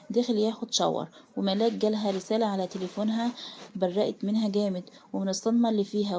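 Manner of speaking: ordinary delivery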